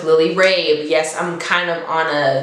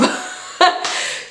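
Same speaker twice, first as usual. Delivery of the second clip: breathless giggle; 12 semitones higher than usual. Real, not acted. Giggling at own casting pick.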